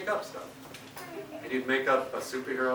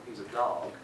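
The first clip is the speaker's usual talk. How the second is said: ordinary delivery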